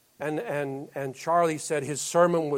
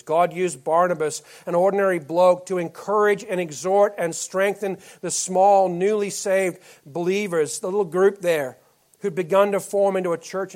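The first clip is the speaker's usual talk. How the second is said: louder preaching on an ordinary bloke; building sermon; 4 semitones higher, 7 dB louder than usual. Acted. Pastor exhorting the church about ordinary Barnabas, rising preaching. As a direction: lift it, proclaim it to the room.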